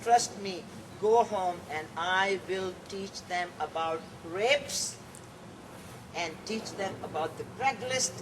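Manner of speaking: usual speaking voice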